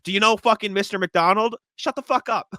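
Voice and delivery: high-pitched scornful retort; mocking outburst. Podcaster mocking a customer who name-drops owners, scornful outburst. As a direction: throw it back at him in a high, mocking voice.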